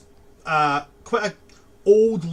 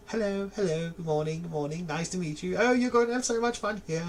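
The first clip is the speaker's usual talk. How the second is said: higher, fast, chirpy greeting; chirpy door; 6 semitones higher than usual. Acted. Felicity door greeting students stepping through, high and chirpy. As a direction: greet everyone like an eager hostess. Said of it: "they're just like".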